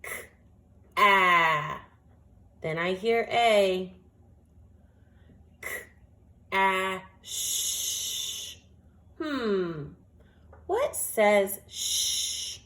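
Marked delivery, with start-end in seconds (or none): 0.0-8.6 K, ah, sh said as separate sounds; sounding out. Shown as a demonstration. Teacher sounds out the word one sound at a time. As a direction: break cash into K, ah and sh with pauses. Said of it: "Let's say it slowly first".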